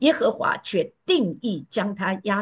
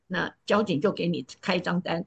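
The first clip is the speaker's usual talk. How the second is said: normal talking voice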